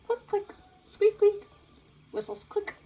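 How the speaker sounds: high squeaky dolphin noises; high chirpy dolphin imitation; dolphin clicks. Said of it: "It's more of a click, whistle, squeak".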